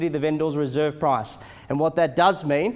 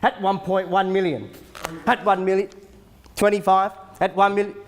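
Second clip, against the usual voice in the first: auctioneer calling bids; 3 semitones higher, 3 dB louder than usual. Acted. Live auction bidding calls.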